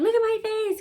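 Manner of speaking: high-pitched mock complaint; mocking mimicry. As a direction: imitate her whining accusation up high. Mimics the screaming woman.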